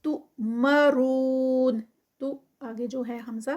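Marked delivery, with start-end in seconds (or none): 0.0-1.8 تؤمرون with ہمزہ jerk and رون stretched four counts; slow chant; tajweed recitation. Shown as a demonstration. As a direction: hold the madd four counts. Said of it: "four counts کا stretch".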